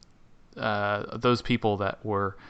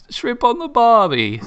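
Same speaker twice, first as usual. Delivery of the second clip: hammed-up Australian, much higher and slow; a cartoonish Aussie accent, high and drawn out; mock Australian accent; 12 semitones higher, 5 dB louder than usual. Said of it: "there's Australian".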